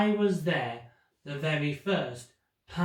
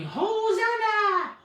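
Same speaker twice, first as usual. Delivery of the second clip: crowd cheering; 4 dB louder than usual. Retold or imitated. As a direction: hail the king like a jubilant crowd. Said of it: "waving banners and shouting".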